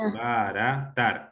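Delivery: "Desbaratar" slowly divided as des-ba-ra-tar; syllable by syllable. Shown as a demonstration. Teacher counting syllables for the class, syllable by syllable. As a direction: separate the four syllables of "Desbaratar". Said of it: "¿Cuántas sílabas presenta la palabra".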